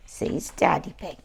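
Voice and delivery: ordinary delivery, nothing marked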